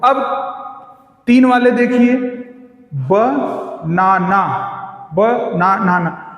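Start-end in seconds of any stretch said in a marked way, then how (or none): 2.9-4.7 बनाना split slowly as ब, ना, ना; three separate beats in बनाना: ब, ना, ना; syllable by syllable. Shown as a demonstration. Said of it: "इसमें तीन सिलेबल हैं।". 5.1-6.3 बनाना split slowly as ब, ना, ना; three separate beats in बनाना: ब, ना, ना; syllable by syllable. Shown as a demonstration. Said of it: "इसमें तीन सिलेबल हैं।".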